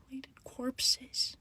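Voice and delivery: slow, barely voiced whisper; hushed whisper. Real, not acted. Storyteller revealing the threatening pictures, hushed whisper. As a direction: whisper the grisly words slowly. Avoiding saying grisly words aloud.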